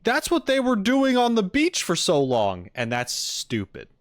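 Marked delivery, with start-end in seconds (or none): 0.0-2.7 high-pitched, loud mock of commenters; mimicking commenters. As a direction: throw the quote out high and insistent. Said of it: "because people were like".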